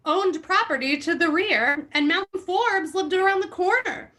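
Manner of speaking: reading a memoir aloud with mock gravity; lightly hammed-up reading of a memoir; dramatic book reading. Host reads Ed Russo's book to friends, mock-dramatic reading.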